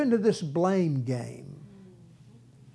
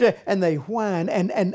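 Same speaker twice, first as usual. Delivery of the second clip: normal talking voice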